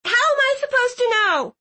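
high-pitched exasperated retort; testy girl's voice; snapped. A narrator's reading. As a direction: snap the question back, pitched high. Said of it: "Clove snapped".

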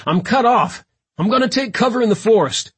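loud, high, pressed radio report; urgent shouting. A narrator's reading. Cut-off officer reporting to the team, urgent shout. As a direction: shout your plan while under attack.

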